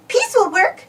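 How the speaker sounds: high, pleased cartoon mouse voice; delighted high mouse voice; Minnie voice. Minnie finds peas in the garden, high pleased voice. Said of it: "she said".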